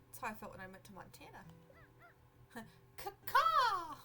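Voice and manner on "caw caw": laughing high crow caw. Acted. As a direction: squawk like a crow through a laugh. Crow companion voiced in game.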